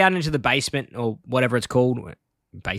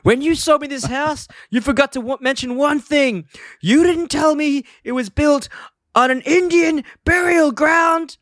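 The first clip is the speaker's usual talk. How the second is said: slow, high-pitched outraged phone rant; hammed-up angry customer, pitched way up; irate Homer; 4 dB louder, slower than usual. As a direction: draw out the accusation in a high, mock-furious voice. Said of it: "he's really angry, he's irate".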